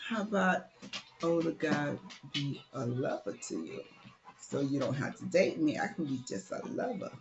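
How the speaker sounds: low, soft, lightly voiced seducer; older suitor. Older man offers to be her lover, softly coaxing.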